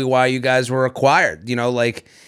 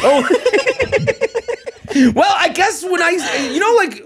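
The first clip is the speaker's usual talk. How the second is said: laughing; 13 semitones higher, slower than usual. Real, not acted. Laughing at being caught out.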